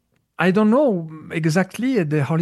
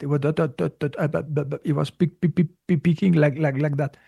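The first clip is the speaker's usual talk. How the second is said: imitated stutter. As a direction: mimic someone stuttering on repeated syllables. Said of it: "tuttering. You say that?".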